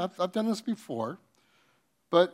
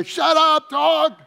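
much higher, much louder shout; man yelling from his door at the barking dog, furious shout; 11 semitones higher, 9 dB louder, slower than usual. Retold or imitated. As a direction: bellow it out the door.

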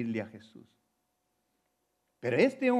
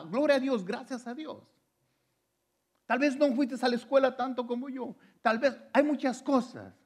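much higher, ringing preacher's cry with gloria a Dios; high-pitched preaching; 10 semitones higher than usual. Acted. Pastor exhorting the educated and uneducated alike, fervent high preaching. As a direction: lift it up in fervent praise.

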